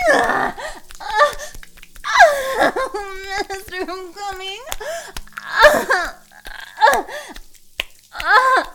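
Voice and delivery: loud high climax moans; orgasm cries.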